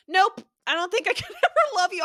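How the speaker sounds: high, loud blunt "nope" through a laugh; mock his cold refusal, laughing; laughing mock rejection. Reader imitating his rejection, high and laughing. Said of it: "for him to be like".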